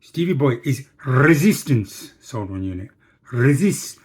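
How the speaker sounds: imitated accent with a rolled R on "resistance"; Mister Subramaniam. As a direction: put on his accent, hitting "resistance" loudly. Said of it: "He'd go".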